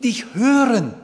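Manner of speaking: loud, high pleading cry to God; David crying out to God, loud and pleading. Acted. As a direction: plead aloud like a man at the end of his rope. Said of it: "zu Gott geschrien und sagt".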